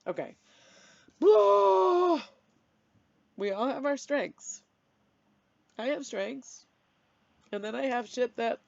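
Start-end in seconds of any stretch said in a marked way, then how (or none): 1.2-2.2 long loud high sigh of release; big sigh. Real, not acted. Calming herself after the rant, a big loud sigh. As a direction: let it all out in one sigh.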